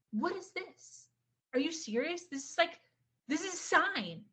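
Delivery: high-pitched, incredulous questioning; retold disbelief. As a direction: act out stunned disbelief, voice lifted high. Retells her stunned disbelief at car.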